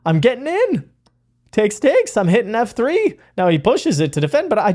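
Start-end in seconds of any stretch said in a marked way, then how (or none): 0.0-3.2 excited inner voice. Retold or imitated. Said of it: "I'm like".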